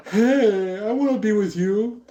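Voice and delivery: high, lightly hammed-up come-on; mock eager suitor. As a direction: pipe up as an eager would-be lover. Acted eager suitor jumping in.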